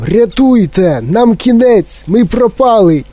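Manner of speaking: high, drawn-out wail of doom; panicked cry. A narrator's reading. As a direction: cry for help as if all is lost. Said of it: "дідунь Джо закричав".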